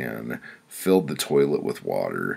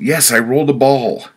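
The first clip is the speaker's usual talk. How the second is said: pained yell; 10 semitones higher, 11 dB louder than usual. A narrator's reading. Narrator in pain answers wife.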